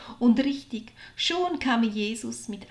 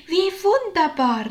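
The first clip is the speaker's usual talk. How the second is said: high, loud cry of joy; jubilant exclamation; 7 semitones higher, 8 dB louder than usual. A narrator's reading. Maria rejoices at the news of Jesus, jubilant exclamation. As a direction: exclaim with delight, voice lifted. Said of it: "rief sie".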